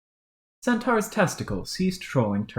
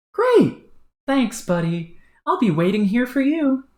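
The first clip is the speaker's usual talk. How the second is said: much higher, bright eager thanks; chipper troll; 13 semitones higher, 6 dB louder than usual. Acted. Troll thanking his partner, higher and eager. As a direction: gush with delight. Excited brash voice.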